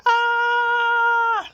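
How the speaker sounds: long, high, loud scream; high-pitched cry of exasperation; frustrated scream. Real, not acted. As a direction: vent with one sustained "Ah". Venting after mail clerk story.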